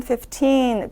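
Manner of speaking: "fifteen" stressed on the second syllable, the end drawn out; stress on TEEN. Shown as a demonstration. Teacher contrasting fifteen with fifty for students, stress on TEEN. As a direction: lean on the T and stretch "teen". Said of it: "T에 강세를 두고 뒤를 조금 끌어".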